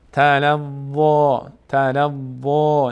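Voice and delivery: "تلظا" slowly, with a full heavy final aa; full heavy vowel. Shown as a demonstration. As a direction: round and lengthen the "ظا" of "تلظا".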